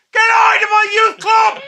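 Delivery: full-volume high-pitched Belfast shout; Belfast bellow. Acted. Impersonating a teacher throwing kids out of youth club, full bellow. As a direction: bellow it like Peter Johnson clearing the youth club. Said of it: "It's a bellow, isn't it, that Belfast accent?".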